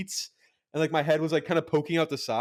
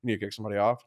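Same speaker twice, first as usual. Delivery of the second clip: pilot announcement; 5 semitones lower, faster than usual. Retold or imitated. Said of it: "I have to be like".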